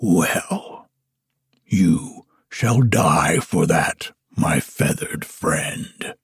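low, menacing, trailing to a hiss; fierce giant. A narrator's reading. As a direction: threaten him softly and coldly. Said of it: "quoth the giant fiercely".

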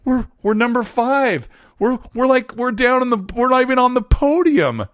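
quoting Satya. Retold or imitated. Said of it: "and he says".

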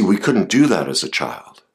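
ordinary delivery

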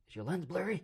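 high-pitched mock call from the woods; high, questioning creature call; Bigfoot calling out.

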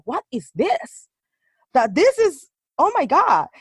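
stunned discovery. Retold or imitated. Recalling what appeared on the TV, astonished. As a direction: replay the shock with big pitch swoops. Acts out first shocked discovery.

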